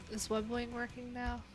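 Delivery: usual speaking voice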